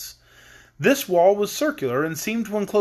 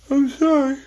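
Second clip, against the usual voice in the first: yawning; 8 semitones higher, 4 dB louder, slower than usual. Real, not acted. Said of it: "I don't know why I'm yawning".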